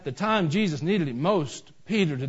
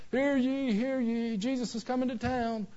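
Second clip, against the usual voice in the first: town crier; 10 semitones higher than usual. Acted. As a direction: herald an arrival like a playful town crier. Peter announcing Jesus up front.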